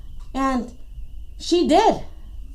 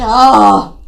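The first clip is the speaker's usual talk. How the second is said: loud, low drawn-out "oh"; exasperated groan; 6 semitones lower, 7 dB louder than usual. Acted. Iguana exasperated before asking.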